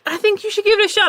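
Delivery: high, quick nudging voice; imitating an insistent, high-pitched director; mock coaxing. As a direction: coax them in a high, hurried voice. Director coaxing reluctant actor.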